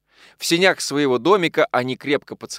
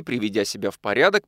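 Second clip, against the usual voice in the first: ordinary delivery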